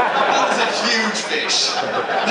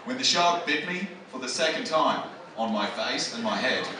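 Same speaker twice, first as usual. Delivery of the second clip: mock-grave, pitched-down Australian testimony; serious; 8 semitones lower, 5 dB quieter than usual. Shark survivor recalls the second bite to camera, serious. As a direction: imitate a survivor turning solemn, low and quieter. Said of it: "he just kinda went serious".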